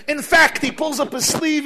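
usual speaking voice

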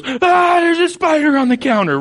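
high-pitched mock scream sliding into panicked words; mock spider panic. Meteorologist spots a spider mid-broadcast, mock panic.

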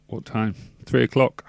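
ordinary talk

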